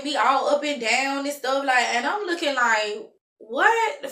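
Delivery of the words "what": sharp high "What?"; offended disbelief at a stranger's glare; indignant what. Retold or imitated. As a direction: snap back a startled what. Stranger glaring at her.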